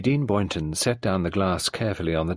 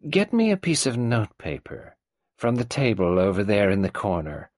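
put-on old tyrant mother. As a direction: play the domineering old woman. Mrs Boynton ordering.

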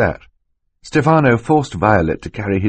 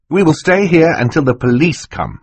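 put-on high, raised boy's voice; defiant boy; 9 semitones higher, 4 dB louder than usual. Klaus refusing to go until police come, defiant. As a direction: insist firmly, pitched up.

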